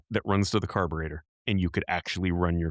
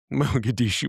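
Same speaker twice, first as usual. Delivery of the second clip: laughing repeat; 4 semitones higher than usual. Real, not acted.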